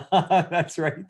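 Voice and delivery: laugh, then agree through it. Real, not acted. Speaker amused by Lori's cat on the call, laughing.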